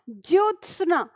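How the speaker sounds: ज्योत्सना said slowly, jyot-sna, half t then sna; careful spelling-out. Shown as a demonstration. Teacher drilling students on the right form: slow ज्योत्सना, half t then sna. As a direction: sound out ज्योत्सना slowly, syllable by syllable. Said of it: "आपको याद रखना है ज्यो".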